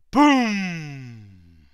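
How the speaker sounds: loud booming blast, fading out; gun boom. Acted. Storyteller booms as the one o'clock gun fires, loud. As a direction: boom like a cannon.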